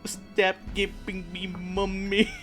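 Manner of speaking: ordinary talk, nothing marked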